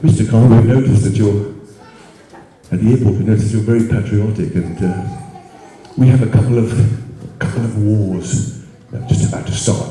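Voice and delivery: low, deep official at the door. Retold or imitated. Immigration officer recruiting the uncle, in a low official voice. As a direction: play a grave officer reciting.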